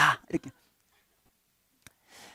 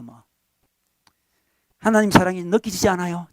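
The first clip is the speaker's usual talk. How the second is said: ordinary talk, nothing marked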